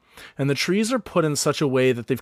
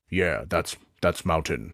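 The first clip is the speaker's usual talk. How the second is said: put-on old orc Stone; 8 semitones lower than usual. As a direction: rumble it like a doting old orc. Stone speaks.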